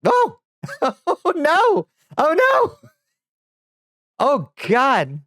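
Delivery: high, loud surprised squeals breaking into laughter. Real, not acted. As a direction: yelp at the broken model, laughing. Avatar glitch breaks unexpectedly.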